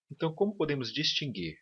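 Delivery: usual speaking voice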